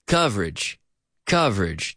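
COVERAGE as CUV-rij, falling; two-syllable pronunciation. Shown as a demonstration. Teacher modelling media coverage in English, two syllables. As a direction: say coverage without its middle vowel. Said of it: "Two syllables".

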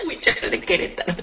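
laughing. Real, not acted. Laughing at the new nickname.

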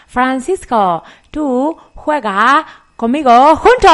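put-on slow, foreign-accented broken Spanish; Cambodian accent. Grace inviting Francisco to play, in broken Spanish with a foreign accent. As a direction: speak slowly like a Cambodian learner. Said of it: "El inglés de Grace".

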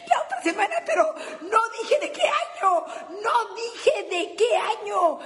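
giggling punchline; laughing punchline. Real, not acted. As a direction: crack up at your own joke.

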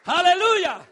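loud ringing aleluya; joyful shout of praise; shouted hallelujah. Real, not acted. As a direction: throw it out to the crowd. Preacher exclaims to congregation.

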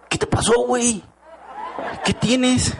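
high, startled and half-laughing; mock worried buddy asking what happened; alarmed friend. Friends replying alarmed to message.

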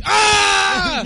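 very high, loud, drawn-out animal shriek; animal scream imitation. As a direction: shriek like the screaming creature in the video. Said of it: "como un tipo oso grita". (chillido de animal imitado)

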